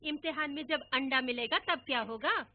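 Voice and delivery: mother, irritated, answering father loudly; irritated retort. Retold or imitated. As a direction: hit back like an annoyed mother. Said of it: "माँ चिढ़कर जवाब देती".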